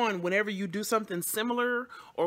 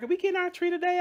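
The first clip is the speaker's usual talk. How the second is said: put-on much higher, louder child-like asking; Lena's voice; 13 semitones higher, 5 dB louder, faster than usual. Lena eagerly asking mom about the tree, high child voice. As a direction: pitch way up as an eager kid. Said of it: "Lena was like".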